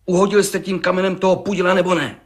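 fast, high-pitched interrogation; insistent demand. Acted. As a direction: press hard for a yes or no. Judge presses the final question.

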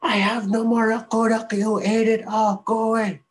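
high, loud and firm; shouting back. Acted. Mink shouts through the door at the creature, loud and firm. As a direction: drive the creature away.

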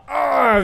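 high, loud cry of pain. Acted. Wounded enemy holding his arm, high pained cry. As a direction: yelp as the hammer lands. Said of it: "the individual goes and holds his arm".

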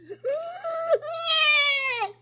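squealing laugh, high and loud; real laugh breaking out high; high laughing shriek. Real, not acted. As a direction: burst into a high delighted squeal. Tickling game laugh.

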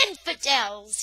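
sharp angry shout of Infidels. A narrator's reading. Daniel shouting at the passing soldiers, angry shout. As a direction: hurl the word at the soldiers. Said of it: "he shouted".

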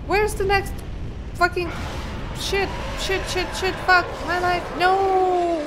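high loud frantic cursing; panicked swearing. Real, not acted.

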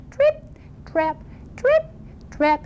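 high little hoofsteps with gaps; dainty, high-pitched trip-trap; teeny tiny noise. Acted. Tiniest goat crossing the bridge, tiny high steps. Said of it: "teeny tiny bridge, little noise like this".

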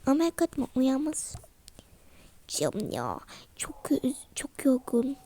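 soft unvoiced whisper so she won't wake; whispering beside a sleeping mother. Real, not acted. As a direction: breathe the words, no voice. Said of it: "Tam sessiz konuş, uyanacak".

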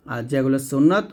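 ordinary talk

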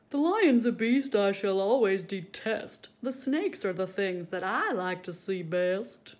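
loud, pompous gentleman voice; stuffy man in crowd. Acted. Man in the crowd scorning the lion, loud and pompous. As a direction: declare it like a haughty gentleman.

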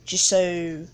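slightly low put-on British accent. Gamer gushing over own shot, mock British accent. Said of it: "talking in a British accent".

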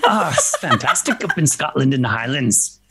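hammed-up Scottish accent, drifting towards Irish. Shown as a demonstration. Showing off Scotland to co-hosts, in a Scottish accent. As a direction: put on a playful Scottish accent. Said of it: "I can do a Scotland accent".

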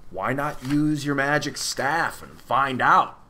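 slow talk round an eyedropper; mouth obstructed. A narrator's reading. Tock suggests the staff to Milo, talking round an eyedropper. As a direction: speak carefully with something held in your teeth. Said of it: "as clearly as anyone could with an eyedropper in his mouth".

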